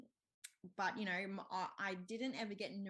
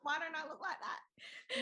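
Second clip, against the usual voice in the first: high, drawn-out comic wail; hammed-up self-pity, half laughing; mock wailing; 8 semitones higher, 3 dB louder, slower than usual. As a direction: whine it through laughter.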